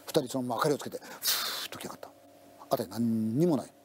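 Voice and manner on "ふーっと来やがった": breathy ghost-story whisper. A narrator's reading. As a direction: hush it to a bare whisper. Eerie moment in ghost story. (ささやき声で)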